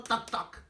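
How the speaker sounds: whispered knocking "toc, toc"; knock sound. Acted. Caperucita knocking on the wolf's door, whispered knock. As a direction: tap it out breathily like knuckles on wood.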